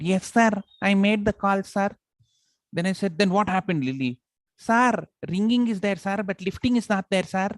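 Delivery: high-pitched polite secretary; shriller secretary voice. Retold or imitated. Boss retells secretary Lily reporting the unanswered call, shrill. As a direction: squeak it up like a timid secretary. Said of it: "I made it a little shriller".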